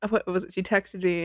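ordinary talk, nothing marked

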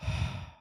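demonstrated slow audible exhale; modelled calming sigh; deep breaths. Shown as a demonstration. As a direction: breathe out deeply to calm yourself. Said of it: "So I'm gonna take deep breaths".

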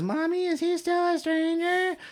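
very high, slow child impression; squeaky mock little kid; little girl voice. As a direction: draw it out in a tiny high voice. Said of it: "the little girl comes in and says".

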